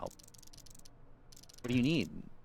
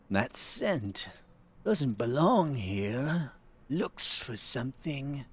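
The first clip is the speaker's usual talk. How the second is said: high, slow hissing prisoner voice; snake voice; 7 semitones higher, much slower than usual. Acted. Blind prisoner sizing up a stranger, snake voice. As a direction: slither the words out like a snake. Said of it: "the snake voice".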